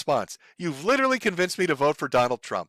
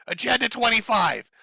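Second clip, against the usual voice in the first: mock screaming Trump; 7 semitones higher than usual. Imitating an imagined Trump screaming at crowds, mock scream. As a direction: shriek it like a cartoon dictator. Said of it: "as he screams".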